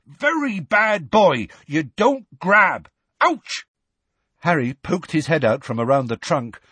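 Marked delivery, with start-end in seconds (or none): 0.1-2.9 slow, high, emphatic scolding; Hagrid yelling. A narrator's reading. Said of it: "they heard Hagrid yelling". 3.2-3.7 sudden high yelp of pain; Hagrid yelping. A narrator's reading. Hagrid is hit on nose.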